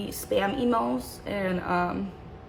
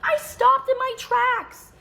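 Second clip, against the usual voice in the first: much higher, mocking his own words; high mocking quote; 3 dB louder than usual. Mocking the ex's line back at him, high and squeaky. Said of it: "I was like".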